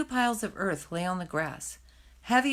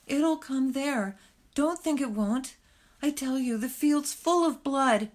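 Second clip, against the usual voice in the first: higher and slow, desperate dread; panicked insistence; 7 semitones higher, slower than usual. Acted. Fiver insists to Hazel that danger will follow, higher and slow in panic. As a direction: insist through the fear.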